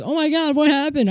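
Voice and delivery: very high gasping question; a neighbor aghast at the blood; shocked neighbor. Retold or imitated. Neighbor finds the kid hurt, very high shocked cry. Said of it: "the neighbor came out and was like".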